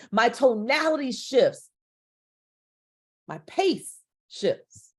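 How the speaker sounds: ordinary talk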